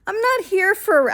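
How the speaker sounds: loud, high outburst; indignant. Retold or imitated. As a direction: snap back, offended. Acted indignant retort to clerk.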